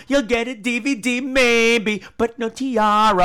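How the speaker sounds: high, loud, slow sing-song gloating; high loud mock-gloating about the tiara; campy taunt. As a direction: rub it in like a diva.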